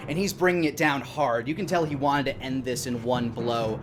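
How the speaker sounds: normal talking voice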